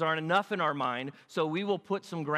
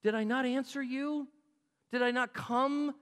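higher-pitched pleading rhetorical questions; impassioned reminding; 7 semitones higher than usual. Acted. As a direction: press each question as a wounded reminder. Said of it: "He's reminding them".

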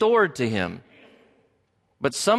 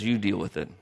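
dismissive brush-off; 6 semitones lower, 6 dB quieter than usual. Retold or imitated. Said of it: "Abram says".

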